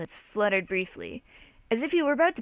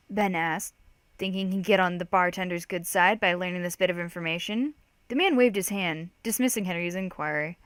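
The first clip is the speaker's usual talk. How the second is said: ordinary delivery, nothing marked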